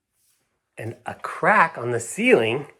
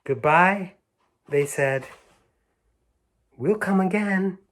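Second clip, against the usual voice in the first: put-on girls' goodbye; 8 semitones higher, 4 dB louder than usual.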